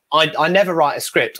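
usual speaking voice

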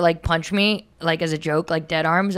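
ordinary delivery

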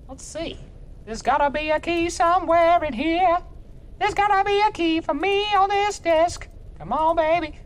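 very high, sing-song searching; high put-on voice. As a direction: coo to yourself while hunting the desk.

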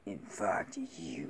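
soft, whispered monster voice; Venom. Shown as a demonstration. Man practising a film villain's line, whispered monster impression. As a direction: whisper it like a creature sizing up organs. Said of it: "I got his voice down".

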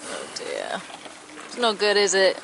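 normal talking voice

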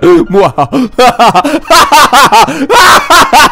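a big hammy evil laugh; evil villain laugh. Acted. Villain laugh after speech.

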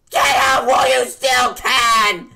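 high, loud sudden yell; sudden shout. Acted. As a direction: blurt it out as a scream. Sudden warning after calm setup.

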